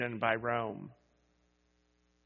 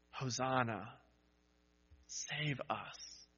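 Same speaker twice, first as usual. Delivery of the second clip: soft, near-breathless plea; quiet cry; 9 dB quieter, slower than usual. Acted. Preacher voices the crowd's last, hushed cry to Jesus. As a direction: let the cry fall to almost nothing. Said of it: "they cried".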